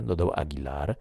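ordinary delivery, nothing marked

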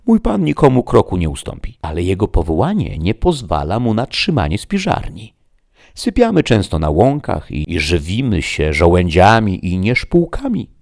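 loud, animated Sancho; proud squire, talkative. Acted. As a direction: speak up for the master with emphasis. Sancho boasts of his master.

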